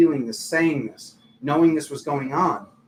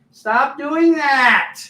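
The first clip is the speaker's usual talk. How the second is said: much higher, louder, very slow command; mock stern yell; 10 semitones higher, 6 dB louder, much slower than usual. Angels scolding the sleeper, slow, high mock yell. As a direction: bark it slowly like a scolding angel.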